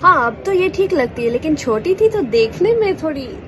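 fast, high gossiping auntie voice in Hindi; imitating gossiping aunties. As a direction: rattle off the aunties' catty remark in Hindi. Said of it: "And they say".

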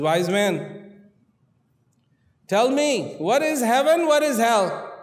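high, loud royal demand; king commanding the wise man to answer. Acted. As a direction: call out the question like a king who expects an answer. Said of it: "He says".

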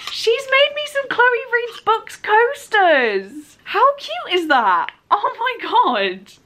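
high, slow, thrilled exclamations; delighted gasp. Real, not acted. Opening her friend's gift, thrilled and high-pitched. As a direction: gush over the handmade coasters. Opening a handmade birthday present.

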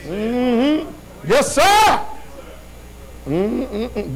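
usual speaking voice

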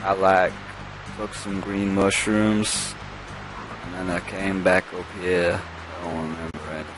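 stoner voice. Pretend. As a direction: drift through it like a stoner. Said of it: "I'm gonna stop with the stoner voice".

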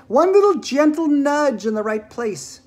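high, slow, loud narration; gleeful build-up. A narrator's reading. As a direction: draw it out high with relish. Narrator savouring the coming push.

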